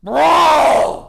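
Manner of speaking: loud, high, drawn-out yell; outraged shout. Real, not acted. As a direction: bellow it in disbelief. Outraged at the list.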